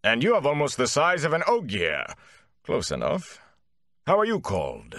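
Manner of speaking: sizing-up Gleeman. A narrator's reading. Gleeman sizes up tall Rand, appraising. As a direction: size up the tall lad.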